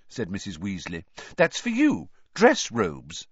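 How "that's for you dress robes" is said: high, firm mum voice; motherly voice. A narrator's reading. Mother telling son the robes are his, high and firm. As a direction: announce it like a no-nonsense mother. Mrs Weasley explaining robes.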